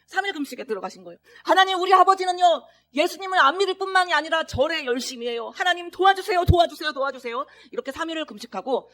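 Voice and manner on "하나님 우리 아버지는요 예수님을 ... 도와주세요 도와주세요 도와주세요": raised, pleading cry to God; loud pleading prayer. Retold or imitated. Praying for her unbelieving father, loud pleading cry. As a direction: beg God loudly for a father's life.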